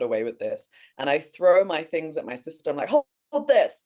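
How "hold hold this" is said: urgent. Retold or imitated. Urgent dash after stolen dog.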